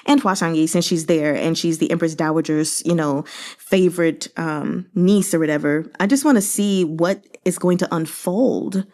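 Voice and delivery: ordinary delivery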